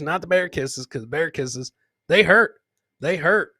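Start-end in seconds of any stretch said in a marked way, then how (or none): 2.1-3.5 sudden loud emphatic burst; loud punchline. Real, not acted. Host warning viewers about bear kisses, loud burst.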